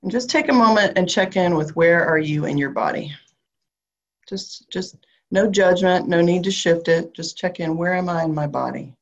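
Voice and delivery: calm grounding visualization, unhurried; guided meditation. Acted. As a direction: guide them gently down into the body.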